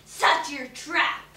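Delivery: slow, loud snapped order; the witch ordering the girl about. A narrator's reading. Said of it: "Alice Nizzy Nazzy snapped".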